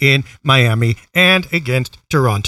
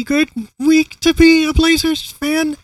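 higher announcer-style quote; Mike Rice impression; 11 semitones higher than usual. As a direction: do the TV color commentator's catchphrase. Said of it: "As Mike Rice would say".

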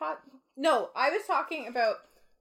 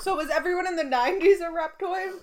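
excited question; 7 semitones higher, 7 dB louder than usual. Retold or imitated. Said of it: "you were like".

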